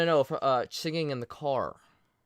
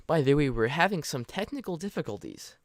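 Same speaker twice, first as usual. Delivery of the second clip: normal talking voice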